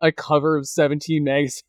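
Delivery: ordinary delivery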